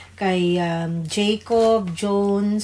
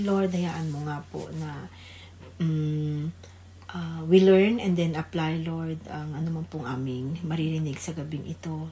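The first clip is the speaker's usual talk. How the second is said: hushed, earnest prayer voice; quiet heartfelt church prayer; opening prayer; 5 dB quieter than usual. Real, not acted. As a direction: pray softly to the Lord.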